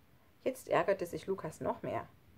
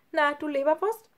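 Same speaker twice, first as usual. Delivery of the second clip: higher, louder playful dad; teasing; 9 semitones higher, 5 dB louder than usual. A narrator's reading. Dad to Lukas in passing, teasing. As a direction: tease him in passing, raised voice.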